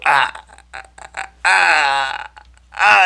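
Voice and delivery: high, loud "ah" bursts; hyped yelps. Retold or imitated. Player celebrating his introduction, excited yelps. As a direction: let out short celebratory yells.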